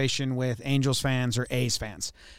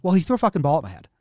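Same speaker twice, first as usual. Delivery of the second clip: fast retold Canha; 2 semitones higher, much faster than usual. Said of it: "he just goes".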